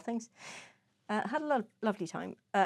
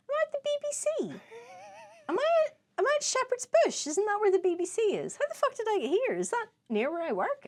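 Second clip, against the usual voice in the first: high, bewildered squeak; high confused voice; reading texts in a lost voice; 12 semitones higher, faster than usual. Retold or imitated. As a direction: play a clueless lost texter. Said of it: "the next text message was".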